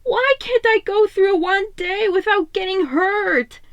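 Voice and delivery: high, tearful plea with a breaking voice; voice cracking. A narrator's reading. Hurt Donnie crying out to the empty walls, voice cracking. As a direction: let the voice crack through tears. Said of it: "Donnie's voice cracked".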